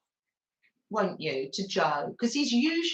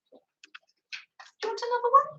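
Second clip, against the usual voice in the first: pet talk; 12 semitones higher, much faster than usual. Real, not acted. Offering the dog a treat.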